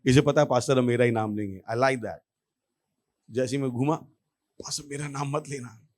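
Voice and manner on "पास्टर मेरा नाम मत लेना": very soft, under the breath; hushed silent plea. Retold or imitated. Congregant silently begging pastor not to pick her, hushed. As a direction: plead almost inaudibly.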